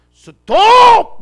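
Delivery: dramatic shout. Acted. Jesus halting the widow's son's funeral, long loud shout. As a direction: stop the procession with one huge call.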